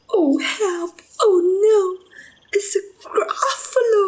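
very high squealed alarm; panicked mouse. Acted. Mouse meeting the real Gruffalo, high panicked squeal. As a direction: squeal in acted terror.

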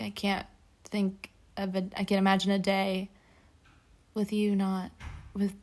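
haltingly recited, with false starts; imitating halting speech. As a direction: stumble through it as if struggling to read. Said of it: "That's exactly what it sounded like".

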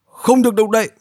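high, sharp command; barked order. A narrator's reading. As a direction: order them to freeze. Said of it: "Các tráng sĩ lại quát lên".

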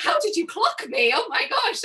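high, loud flustered squeal; mock panic at being found out; caught-out surprise. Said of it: "I was like".

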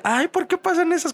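very high scandalized grandma; shrill old lady shock. Retold or imitated. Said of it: "mi abuela como de". (abuela escandalizada, voz muy aguda)

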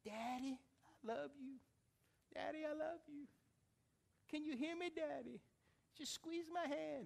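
high, soft, pleading to Daddy; tearful daughter. Retold or imitated. Crying daughter pleading with her father in hospital, tearful. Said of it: "and she's crying".

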